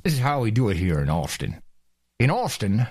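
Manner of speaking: low, tight-jawed Texan; Texas accent through teeth. Acted. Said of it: "talking through your teeth".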